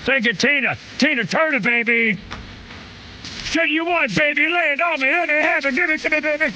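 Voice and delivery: high, excited falsetto riffing to the music; put-on high voice, egging the singer on; hyped-up jive. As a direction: jive along in a squealed-up high voice.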